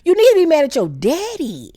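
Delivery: high, drawn-out mocking girl voice; sassy friend voice. Telling the jealous queen off, high mocking drawl. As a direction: stretch out you so jealous, teasing. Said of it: "And I'm like".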